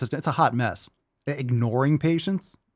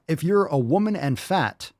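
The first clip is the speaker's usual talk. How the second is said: normal talking voice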